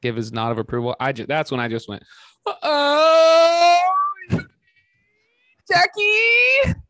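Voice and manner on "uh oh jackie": very high, loud falsetto cry-wail; hammed-up high wailing cry. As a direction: break into a strangled, squealing sob on his name. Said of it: "that's how I sound when I cry".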